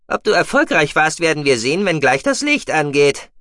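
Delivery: high, loud mousebeaver, mildly gloomy; gloomy Guki. A narrator's reading. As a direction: warn in a piping, forceful voice. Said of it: "meinte Guki düster". (hohe, laute Figurenstimme, leicht düster)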